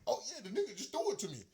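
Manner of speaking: fast, high taunting mimicry; mocking a Bengals player bragging on the sideline. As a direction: ape the bragging player at speed. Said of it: "I'm just translating".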